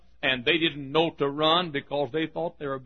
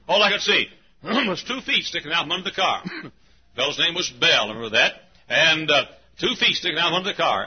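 usual speaking voice

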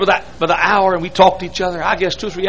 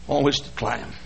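normal talking voice

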